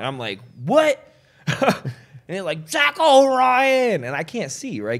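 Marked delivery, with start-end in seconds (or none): 0.6-1.0 high, loud "what?"; startled. Retold or imitated. Comic onstage hears his name from the dark, startled cry. 2.7-4.0 high, loud, drawn-out holler; drunken yell. Retold or imitated. Drunk heckler calls the comic's full name, slow loud yell. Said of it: "Two drunk people yelling at each other".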